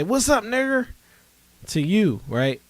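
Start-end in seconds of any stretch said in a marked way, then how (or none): 0.0-0.9 high, loud taunting hello; loud mock greeting. A hypothetical Klan figure hailing you loud and high. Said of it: "And they say".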